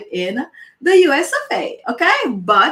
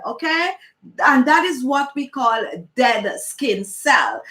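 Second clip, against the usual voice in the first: normal talking voice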